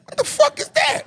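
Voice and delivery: high, alarmed disbelief; startled high cry; shocked. Retold or imitated. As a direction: gasp it out at the dinosaur. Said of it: "So I'm like".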